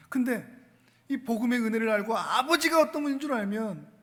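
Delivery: usual speaking voice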